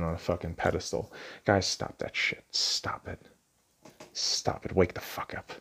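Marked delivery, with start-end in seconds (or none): 1.4-5.5 stern whisper, pressing it; harsh whisper. Real, not acted. Scolding simps in a stern whisper. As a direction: hiss a scolding at the guys.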